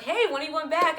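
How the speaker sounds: high, loud, bright mimicry of a nosy acquaintance; mock cheery question. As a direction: play the oblivious friend asking loudly. People asking when she returns.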